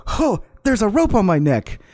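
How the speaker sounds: high-pitched mock panic; mocking alarm. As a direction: mimic helpless alarm, mockingly. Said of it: "leave it on there and go".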